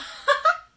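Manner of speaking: a high, loud burst of laughter; delighted laugh. Real, not acted. Delighted at the card drawn.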